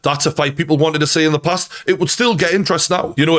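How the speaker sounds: normal talking voice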